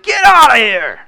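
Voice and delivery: loud, high angry shout; retold scream. Shop owner chasing off a customer, shouting. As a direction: yell him off the lot. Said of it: "he screamed at him and said".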